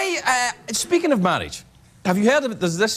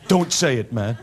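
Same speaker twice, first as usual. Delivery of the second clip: lower, hushed-up protest to a buddy; mock touched acceptance; 5 semitones lower than usual. Buddy overcome by his pal's proposal, mock tender. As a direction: play it as a moved sweetheart, restrained.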